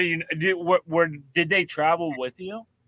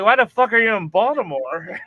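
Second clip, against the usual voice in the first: higher, louder incredulous outburst; mock disbelief; 5 semitones higher, 6 dB louder than usual. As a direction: act out his shocked reaction, raised. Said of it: "I was like".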